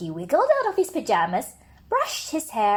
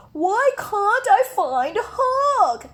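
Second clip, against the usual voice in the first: whiny sad bear; 10 semitones higher, 4 dB louder, slower than usual. Acted.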